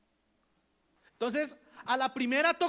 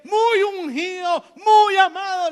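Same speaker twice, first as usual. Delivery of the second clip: high, loud, slow shout of praise; rising preacher's cry; pulpit shout; 7 semitones higher, 11 dB louder, slower than usual. Real, not acted. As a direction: let it ring out over the church. Preacher peaks on Bernabé's praise.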